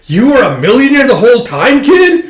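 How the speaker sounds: high, loud, stunned disbelief; shocked yelp. Retold or imitated. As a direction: gasp it out like he just found out. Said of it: "He's like".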